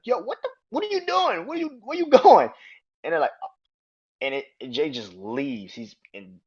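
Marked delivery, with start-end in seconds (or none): 0.1-2.5 high, rising to a loud call; baffled Roman. Retold or imitated. Roman calling after Jay as he walks out, shocked. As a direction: call after him in disbelief. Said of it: "And Roman's like".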